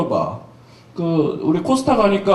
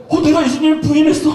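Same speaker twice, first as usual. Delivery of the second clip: panicked Peter; 10 semitones higher, 4 dB louder, faster than usual. Retold or imitated. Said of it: "라고 생각을 했는데".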